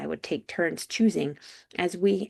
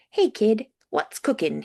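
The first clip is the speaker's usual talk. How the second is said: lightly voiced British greeting; a touch of British accent, louder at the start; casual hello with a hint of British; 4 semitones higher, 6 dB louder than usual. A narrator's reading. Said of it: "candidly in his British accent".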